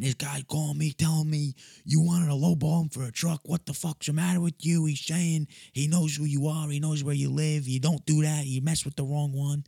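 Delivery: high-pitched imitation of a frantic mother; upset mom. Mom scolds son about the angry caller, imitated upset.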